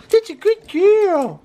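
very high, slow squeaky praise; lavish the dog with sing-song praise; doting praise. Real, not acted.

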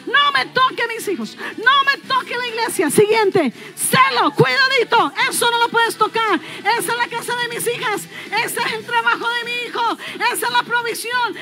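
loud, high, sustained declamation; full-force preaching. Real, not acted. Preacher rallying God's army, preaching at full force. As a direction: preach it out with drawn-out phrase endings.

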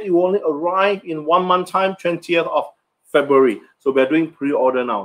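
ordinary delivery

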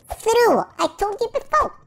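high, exasperated outburst; mock indignation. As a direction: jump up high, insisting. Insists after repeating himself.

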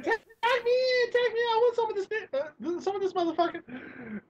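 high, eager put-on woman's voice; Esther. Imitating a sketch woman wanting a turn, eager. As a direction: beg excitedly to join the fight. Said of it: "she's like".

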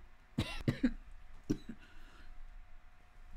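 long chesty coughing fit. Real, not acted. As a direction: hack it out from the chest. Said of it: "I keep coughing".

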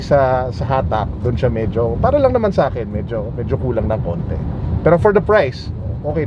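speaking behind cloth covering; through a face mask. Real, not acted. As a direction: mouth covered by fabric.

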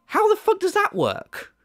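very high, exasperated question; incredulous. Real, not acted. Challenging the critic about his medical excuse, incredulous. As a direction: pitch leaping in disbelief.

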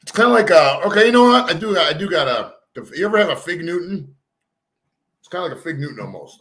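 chewing between words; talking while chewing. Real, not acted. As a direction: mouth partly full of food. Seen 0: Bites mochi at -3; pursed, working mouth, piece in hand throughout.

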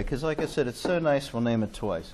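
ordinary delivery

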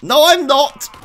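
high, loud comeback; shouted denial. Real, not acted. Player answering a game enemy, shouted denial. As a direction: yell the denial straight back.

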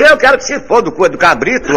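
defiant punchline. Acted. Defiant punchline reply, high loud.

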